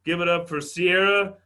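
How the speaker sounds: loud, rising MC call with the name stretched out; big comedy-host intro, held last syllable; announcer introduction. Real, not acted. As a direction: hype the crowd for the next act. Host brings on the next comic.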